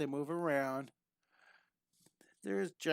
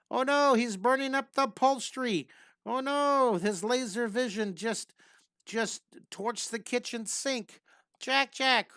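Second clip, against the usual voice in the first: much higher and louder, hammed-up alarm; mock panic; 7 semitones higher, 4 dB louder than usual. Pretend parent reacting to the baby's laser vision, hammed-up panic. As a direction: play a parent panicking over damage.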